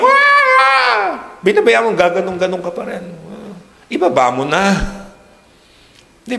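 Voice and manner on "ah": put-on a sudden, very high, loud wailing cry, like a child throwing a tantrum; mock child wail. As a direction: burst into a hammed-up toddler scream. Mimics a crying child in church. (kunwaring iyak ng bata)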